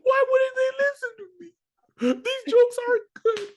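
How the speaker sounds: fake-wail the self-pity for laughs; mock crying. Comic at the mirror, mock crying over his jokes. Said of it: "if you haven't cried in comedy".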